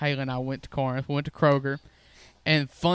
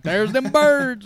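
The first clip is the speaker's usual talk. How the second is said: much higher, louder and slow, a hammed-up cry of triumph; mock excited discovery; 9 semitones higher, 7 dB louder, much slower than usual. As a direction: stretch it out like finding treasure. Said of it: "I was like".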